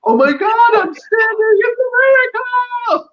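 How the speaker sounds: very high, loud hammed-up squeal; mock amazed cry. Acting out Johnny hailing a miracle, hammed-up amazement. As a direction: cry it out in exaggerated wonder.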